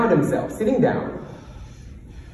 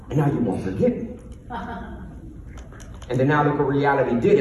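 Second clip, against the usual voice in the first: normal talking voice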